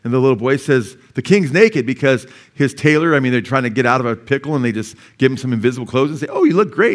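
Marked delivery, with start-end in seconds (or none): 1.1-1.8 higher and louder, blurting the truth; boy calling out. Retold or imitated. Little boy in the fable blurts out, loud and high. 6.3-6.9 high, loud, fawning tailor voice; mock flattery. Tailor flattering the naked emperor, hammed-up fawning.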